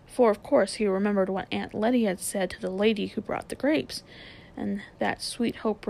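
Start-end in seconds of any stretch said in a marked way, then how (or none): none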